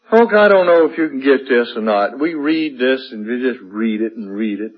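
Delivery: normal talking voice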